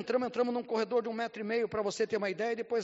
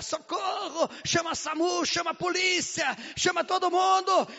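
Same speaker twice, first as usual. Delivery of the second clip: much higher and louder shouted cries for help; panicked screaming; 9 semitones higher, 6 dB louder than usual. Retold or imitated. Women scream for help as the girl falls, panicked shouting. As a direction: yell for rescue in panic. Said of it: "começou a gritar".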